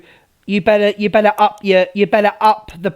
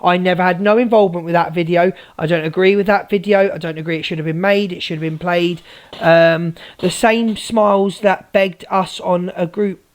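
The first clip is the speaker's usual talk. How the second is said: normal talking voice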